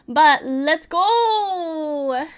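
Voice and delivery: loud, very slow, drawn-out cheer; loud drawn-out let's go; hype. Real, not acted. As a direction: stretch it out like a hype call. Starting the new chapter.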